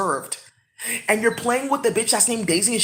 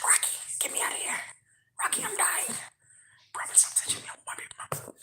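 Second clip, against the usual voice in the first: breathy unvoiced whisper, pleading; whispered plea; 10 semitones higher, 15 dB quieter than usual. Robin begging Rocky for rescue, whispered. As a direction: hush it like a trapped woman begging.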